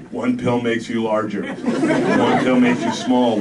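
slow, loud, spaced-out; stoned private answering his lieutenant, spaced out; dazed stoner. Acted. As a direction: drift through it stoned. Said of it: "Will explained".

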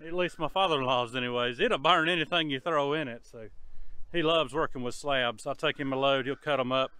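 usual speaking voice

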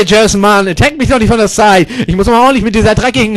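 usual speaking voice

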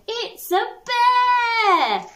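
drawn-out sung wail of fear, very slow; alarmed cry. Acted. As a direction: wail it as the bees panic. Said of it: "they cried".

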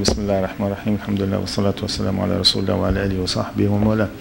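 low, rapid, level recitation; Arabic opening invocation. Real, not acted. Speaker opening a sermon, rapid low invocation.

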